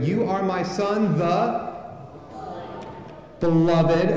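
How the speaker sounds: slow, with a long pause before beloved; fill-in-the-blank prompt. Shown as a demonstration. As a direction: hold back the last word for the crowd. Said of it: "fill in the blanks as I go".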